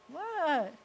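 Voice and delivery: normal talking voice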